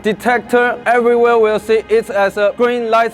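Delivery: measured speech from the podium; formal speech. Acted. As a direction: read it like an address to a crowd. Delivers a rally speech.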